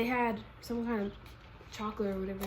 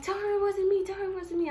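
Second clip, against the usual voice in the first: high-pitched, pleading imitation; mock a girl hurriedly denying blame; frantic denial; 10 semitones higher, 4 dB louder than usual. Rival girl seeing her with sister, mock pleading.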